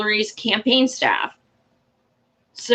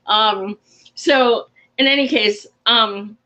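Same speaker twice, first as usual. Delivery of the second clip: ordinary delivery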